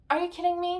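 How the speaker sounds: high, incredulous disbelief at the character; exasperated disbelief. Retold or imitated. Reviewer mocking her own reaction to the heroine, incredulous.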